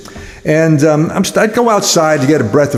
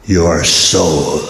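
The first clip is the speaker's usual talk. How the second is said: very deep, ominous devil voice; name the price darkly, low and drawn out; sinister low tempter; devil naming his price; 10 semitones lower than usual. Acted.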